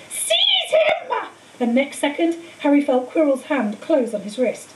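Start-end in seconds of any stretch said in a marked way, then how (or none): 0.1-1.1 much higher shrieking command; scream. A narrator's reading. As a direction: scream the order at the servant. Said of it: "Voldemort screamed".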